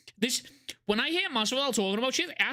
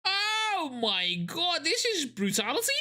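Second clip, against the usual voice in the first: shocked; 8 semitones higher, slower than usual. Real, not acted. Shocked reaction.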